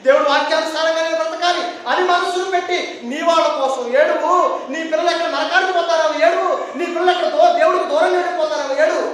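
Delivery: high, loud, driving exhortation; impassioned preaching. Acted. Preacher urging tears for children far from God, impassioned preaching. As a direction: hammer out the repeated plea.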